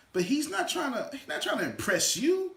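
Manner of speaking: ordinary delivery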